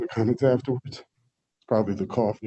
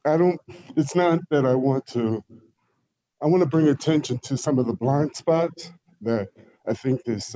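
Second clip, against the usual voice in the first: ordinary talk, nothing marked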